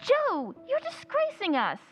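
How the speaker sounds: high-pitched, mortified protest; appalled, high-voiced reproach; scandalised scolding. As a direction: scold an embarrassing sister. Scandalised sister scolds Jo's calling out.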